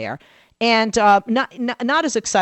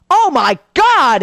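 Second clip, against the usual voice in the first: high, loud, drawn-out oh my God; slow delighted exclamation; thrilled gasp; 9 semitones higher, 7 dB louder, much slower than usual. Retold or imitated. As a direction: stretch it slowly, bowled over. Husband thrilled by debate.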